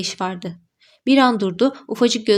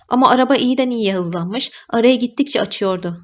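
usual speaking voice

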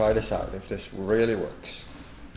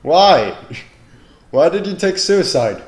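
loud, high disbelieving cry; incredulous shout; 10 semitones higher, 15 dB louder than usual. Real, not acted. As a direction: yell in disbelief. Enemy killed himself.

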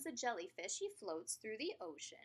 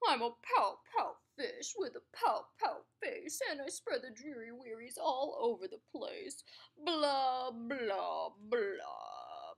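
glum pout fish; 4 dB louder than usual. Acted. The pout fish moaning about his dreary wearies, glum. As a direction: drag it out like a sulky fish. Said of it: "glum, gloomy swimmer with an ever-present pout".

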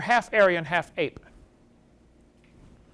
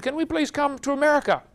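pleading; 8 semitones higher than usual. Retold or imitated. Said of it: "They said".

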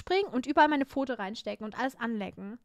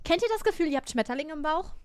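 ordinary delivery

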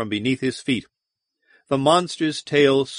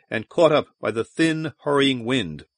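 ordinary delivery, nothing marked

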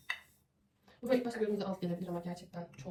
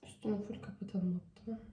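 whisper; 7 dB quieter than usual. Real, not acted. Aside while topping the pudding.

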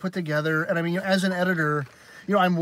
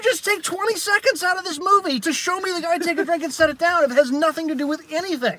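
incredulous rant; 10 semitones higher, 3 dB louder than usual. Retold or imitated. Ranting at the film's editing.

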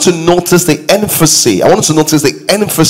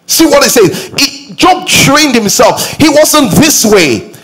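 raised, higher preacher's voice driving the point home; fired-up preacher urging the congregation; preaching at full force; 6 semitones higher than usual. Acted. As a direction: pitch it up and push, like a pulpit climax.

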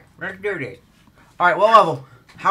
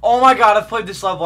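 usual speaking voice

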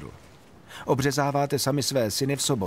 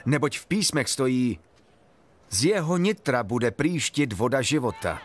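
higher, lifted proclaiming voice; calling out; 6 semitones higher than usual. Acted. Jesus calls out to the festival crowd, high and proclaiming. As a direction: cry it out as an invitation to all. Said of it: "a zvolal".

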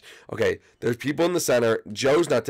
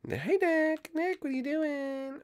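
high mock call; 5 dB quieter, slower than usual. High mock scolding Nick.

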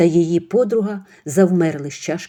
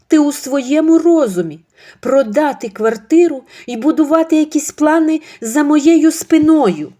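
angry son; 9 semitones higher, 8 dB louder than usual. Acted. Demyd angrily confronting his mother. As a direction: demand an answer furiously.

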